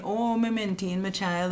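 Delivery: mock quote in a Jamaican accent. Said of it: "sorry to use a Jamaican accent".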